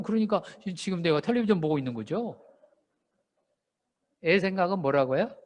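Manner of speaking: normal talking voice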